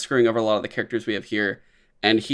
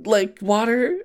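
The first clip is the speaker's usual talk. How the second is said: much higher put-on voice, halting and unsure; polite girl, halting; 11 semitones higher than usual. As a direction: imitate a shy girl groping for English words. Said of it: "she's just kinda like".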